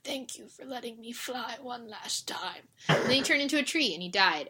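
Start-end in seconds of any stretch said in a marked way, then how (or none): 0.0-2.7 soft dying dragon. Retold or imitated. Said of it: "he was like".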